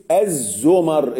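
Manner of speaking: الزمر said slowly as zumar, with the zay in damma and the meem in fatha; الزمر spelled out slowly as zumar for the class; careful correct pronunciation. Shown as a demonstration. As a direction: stretch out الزمر as zu-mar. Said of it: "الزاي مضمومة والميم مفتوحة".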